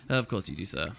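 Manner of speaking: low, soft, fading away; humouring aside. Acted. Player humouring a boastful councillor, quietly patronising. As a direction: placate him under your breath.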